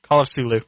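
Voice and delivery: Cthulhu said as Sulu, C dropped. Shown as a demonstration. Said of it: "I'll, I'll lisp it up with the T H".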